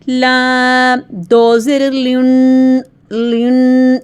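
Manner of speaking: لام، دو زیر، لن spelled out loud and slow; letter drill. Shown as a demonstration. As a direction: sound out لن clearly for the kids. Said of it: "اس کو پڑھیں گے". (بچوں کو لن واضح آواز میں پڑھانا)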